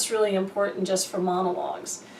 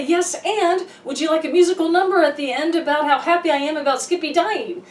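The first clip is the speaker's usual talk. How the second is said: eager yes-and; 6 semitones higher, 5 dB louder than usual. Shown as a demonstration. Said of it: "So you want to say".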